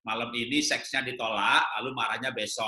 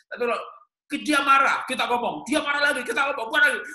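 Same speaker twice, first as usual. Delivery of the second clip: excited build-up; 12 semitones higher, 5 dB louder than usual. Acted. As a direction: voice climbing higher and louder with each clause. Animated example of escalating argument. (makin tinggi dan keras, bersemangat)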